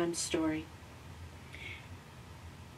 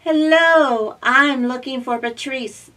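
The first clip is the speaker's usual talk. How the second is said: higher, loud bright robot voice; cheerful little robot; 7 semitones higher, 9 dB louder than usual. Acted. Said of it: "explained Z".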